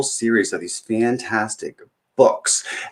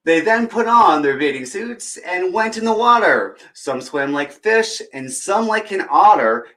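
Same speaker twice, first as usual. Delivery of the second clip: put-on bright, higher read-aloud voice for kids; storybook reading; 8 semitones higher than usual. Storyteller reading a picture book to children, lively. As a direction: read it like story time at the library.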